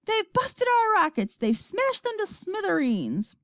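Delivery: high, despairing report; crying out. A narrator's reading. Astronaut reporting wrecked rockets, crying out. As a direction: cry it out, pitch held high. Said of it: "cried Showler".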